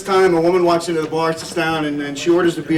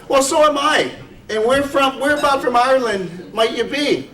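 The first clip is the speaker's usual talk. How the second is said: eager Irish brogue, pitched higher; curious Irish pub bloke, lightly put-on brogue; 7 semitones higher than usual. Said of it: "I'll try to do a little Irish brogue".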